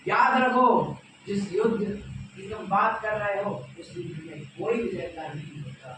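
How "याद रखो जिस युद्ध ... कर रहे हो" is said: high, loud oratorical summons; commanding call. Acted. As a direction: summon every listener loudly. Krishna calls humankind to remember. (बुलंद आवाज़ में आह्वान)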